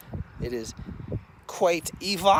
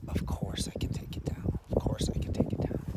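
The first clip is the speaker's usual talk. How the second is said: whispered reassurance. Retold or imitated. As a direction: hush it gently to a child. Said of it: "And I was like".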